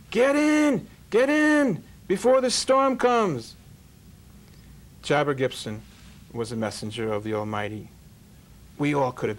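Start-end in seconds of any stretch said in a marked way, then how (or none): 0.1-3.5 very high, loud urgent yell; shouting at the top of his lungs. Retold or imitated. Young driver yelling at people to board before the storm, full shout. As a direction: scream it out the bus window to save people. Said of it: "shouting at the top of his lungs".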